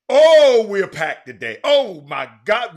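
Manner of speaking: loud, drawn-out hyped-up "oh"; big radio-host excitement, stretching the exclamations; excited exclamation. Real, not acted. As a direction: hype up a packed show. Hyped opening about packed show.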